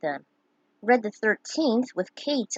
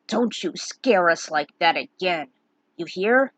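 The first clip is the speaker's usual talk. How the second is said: gruff exclaim; 3 semitones lower, 3 dB louder than usual. Acted. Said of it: "Barrett exclaimed".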